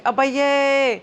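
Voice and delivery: lightly exaggerated wonder; mock amazement. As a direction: act a little amazed at what you see. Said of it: "trying to exaggerate".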